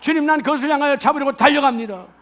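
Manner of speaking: fast, loud proclaiming; rousing sermon proclamation; preaching declaration. Acted. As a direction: declare it with full conviction. Preaching declaration at full force.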